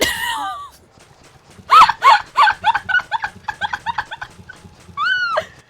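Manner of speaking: high, loud screaming laughter; shrieking laugh. Real, not acted. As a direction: cackle up into a shriek. Laughing scream after failing.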